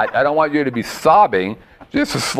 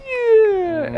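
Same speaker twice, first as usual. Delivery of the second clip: mock scared yeah. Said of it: "So she was like".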